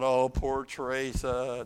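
slow, low pitying sigh of a voice; lightly voiced mock sympathy. Preacher imagining pity for his wife, slow and low. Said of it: "I thought".

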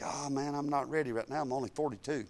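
low, soft half-joking protest; mock reluctance. Preacher mocking his younger self, low and soft. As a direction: grumble softly that you're not ready. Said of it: "Used to, I'd think".

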